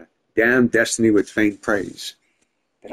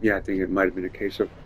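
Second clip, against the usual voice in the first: ordinary talk, nothing marked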